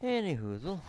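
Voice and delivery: playful Canadian twang on a silly filler; goofy put-on Canadian accent; mock Canadian accent. Gamer slipping into a joking Canadian accent. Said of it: "why am I talking like a Canadian".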